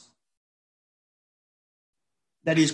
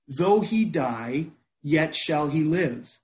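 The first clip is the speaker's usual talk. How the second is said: measured reading; slower than usual. Acted. Quoting Jesus, slowed for weight.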